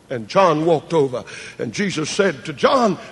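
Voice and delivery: normal talking voice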